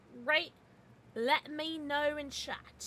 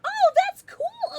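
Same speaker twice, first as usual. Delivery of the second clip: very high, loud, drawn-out exclamation; high excited squeal; 14 dB louder, much slower than usual. Real, not acted. Streamer reacting to the stream, loud high squeal.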